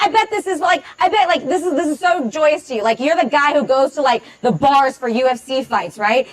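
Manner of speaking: ordinary delivery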